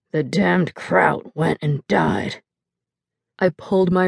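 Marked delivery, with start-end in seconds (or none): 0.1-2.4 put-on slow, low, slurred drunk mumble. Drunk father slumped over the table, slow slurred mumble. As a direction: drag it out heavy with drink.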